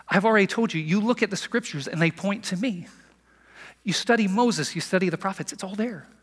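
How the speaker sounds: lightly acted exasperation, making the case; mildly exasperated. Jesus voiced arguing with his doubters.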